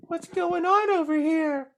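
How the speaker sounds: mocking the little girl peeking in. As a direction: pipe it up like a small girl. Little girl voiced, high.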